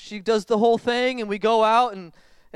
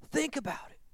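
a breathy, higher, much softer repeat; soft echo; 6 semitones higher, 9 dB quieter than usual. Shown as a demonstration. Preacher repeating softly to the congregation, quiet and higher. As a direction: let it sink in, hushed.

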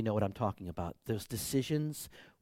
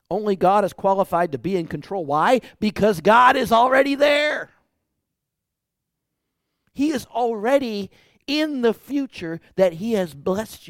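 high, loud proclamation; preaching loud; 7 semitones higher, 7 dB louder than usual. Acted. Pastor proclaims God's control, loud and high. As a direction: proclaim it with full force.

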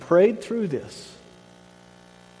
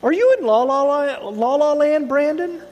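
high, loud, sing-song teacher voice; innocent teacher question; 9 semitones higher, 4 dB louder than usual. Retold or imitated. Teacher asking daydreaming Brandon, high sweet sing-song question. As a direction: tease him sweetly, like a teacher. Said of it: "she just innocently said to him".

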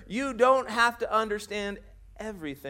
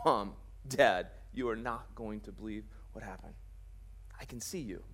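hushed awe; 10 semitones lower, 9 dB quieter than usual. Acted. Healed blind man tells parents.

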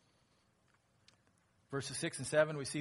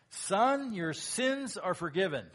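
loud, higher proclamation of forgiveness; Jesus forgiving; 6 semitones higher, 6 dB louder, slower than usual. Acted. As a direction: announce it boldly as Jesus. Jesus speaks to paralyzed man.

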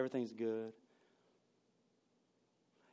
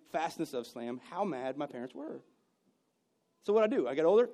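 normal talking voice

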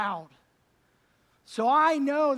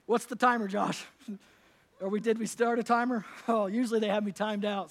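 usual speaking voice

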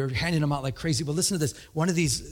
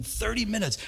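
ordinary talk, nothing marked

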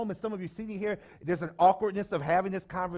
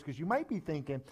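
usual speaking voice

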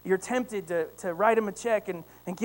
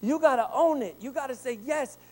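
higher and louder, driving the sermon home; full-force preaching; 5 semitones higher, 4 dB louder than usual. Acted. Pastor urging the church to believe, fervent preaching. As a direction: preach it, rising to a climax.

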